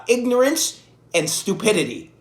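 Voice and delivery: usual speaking voice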